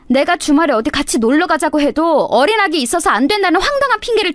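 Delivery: high, sharp shouted reproach; wife shouting her grievances at her husband, high and furious. Acted. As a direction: let the hurt burst out at him. Wife's furious outburst at husband.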